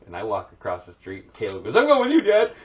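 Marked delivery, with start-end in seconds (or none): 1.8-2.5 put-on high, loud child's shout; excited mock kid yell; eager kid calling out. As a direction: imitate a son yelling after dad. Voices son calling after parent.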